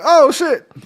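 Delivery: loud, high startled exclamation; surprised oh shit. Retold or imitated. Realizing the joke, a sudden surprised outburst. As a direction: blurt it out as the joke lands.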